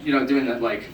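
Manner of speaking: ordinary delivery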